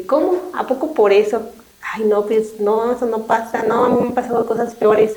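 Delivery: incredulous, brushing off the problem with a high scoff; mock scoffing friend who one-ups them; judgmental dismissive listener. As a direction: play the listener who minimises someone's pain. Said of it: "no juzgar".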